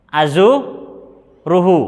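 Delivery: "azuruhu" stretched out slowly. Shown as a demonstration. Teacher showing "azuruhu" with the ta replaced. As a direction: draw out "azuruhu" syllable by syllable. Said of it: "hamzah, atanya diganti ta".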